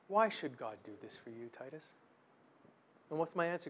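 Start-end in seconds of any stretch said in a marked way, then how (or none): 0.1-1.8 soft, low, gentle insinuation; sweet inner voice. Shown as a demonstration. Adversary posing as the man's own thoughts, sweet soft voice. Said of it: "He talks with a very sweet voice".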